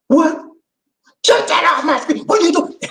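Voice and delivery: high-pitched panicked outburst; hammed-up shocked yelp; mock alarm. Man sees his own recording on screen, acted panicked outburst. Retold victim sees compromising picture of himself.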